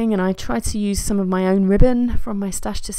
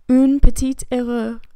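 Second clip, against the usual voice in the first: slow, loud, higher mock-French line; bad French accent; 4 semitones higher, 5 dB louder, slower than usual. Reading her journaling aloud, in a bad French accent. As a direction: put on a clumsy French accent, drawn out. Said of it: "With my worst French accent ever".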